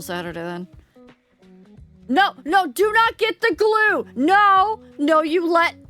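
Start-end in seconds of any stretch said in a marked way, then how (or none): 2.1-5.8 loud, high panicked no; panicked shouting. Real, not acted. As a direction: yell in mock panic, much higher. Begs chat not to use glue.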